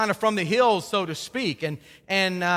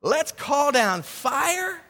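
mock eager zeal; 5 semitones higher, much slower than usual. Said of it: "He's saying".

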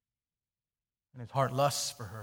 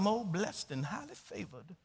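mock pious reply; 4 semitones higher, 6 dB quieter than usual. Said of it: "I'll just say".

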